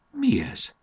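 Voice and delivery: high-pitched astonished question; marvelling, voice jumping up; amazed. A narrator's reading. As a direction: ask in sudden wonder. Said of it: "álmélkodott Fanni".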